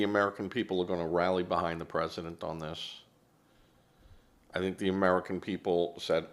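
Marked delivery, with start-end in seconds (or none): none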